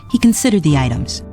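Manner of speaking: ordinary delivery, nothing marked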